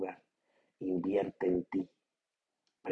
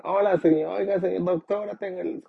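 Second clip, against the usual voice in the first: voz de secretaria, mucho más aguda y algo más fuerte; secretaria imitada; 11 semitones higher, 5 dB louder than usual. Retold or imitated. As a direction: imita a una secretaria amable al teléfono. Secretaria contesta llamada al doctor. (secretaria fingida con voz aguda)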